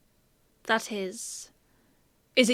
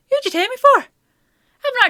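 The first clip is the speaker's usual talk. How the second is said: indignant outburst; 13 semitones higher, 8 dB louder, slower than usual. Acted. Indignant at being misjudged.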